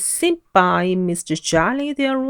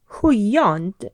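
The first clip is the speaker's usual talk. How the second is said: plain reading voice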